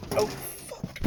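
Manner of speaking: strained gasp mid-effort; effort grunt. Real, not acted. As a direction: curse under strain while lifting. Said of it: "Sorry about all the grunting".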